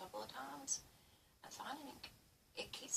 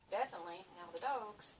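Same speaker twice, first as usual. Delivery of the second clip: usual speaking voice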